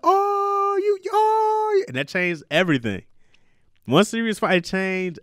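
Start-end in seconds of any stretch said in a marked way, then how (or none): 0.0-1.8 put-on squealing high, loud cooing at the baby; delighted baby talk. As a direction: gush over her like a thrilled dad. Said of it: "and I was like".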